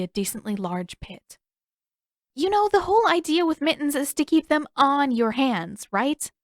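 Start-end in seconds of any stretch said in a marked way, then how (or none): 2.3-6.3 higher, teasing sister; a lightly voiced teasing Katara. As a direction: needle him with mock concern. Katara teasing someone in pit.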